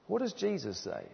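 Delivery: hushed repeated question. Real, not acted. Preacher repeats his question to the congregation, hushed. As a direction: drop the volume right down to repeat it.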